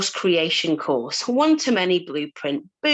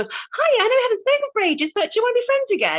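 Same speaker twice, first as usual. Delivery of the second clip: mock sugary friend; faster than usual. Mocking someone testing your boundaries.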